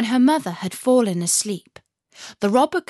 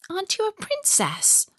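put-on curious girl; 12 semitones higher than usual. A narrator's reading. Robber girl asks.

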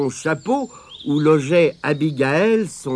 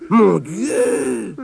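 higher, drawn-out exclamation; alarmed cry; 4 semitones higher than usual. A narrator's reading. Spider hearing someone weeping, crying out. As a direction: cry out in dismay. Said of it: "s'écria Abigail".

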